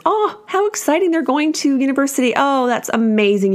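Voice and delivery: high, over-the-top cheery congratulations, imitating her past self; mock gushing. Telling friends' kids' university news, imitating her old bright gush. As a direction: chirp it like polite party small talk. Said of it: "saying to my friends".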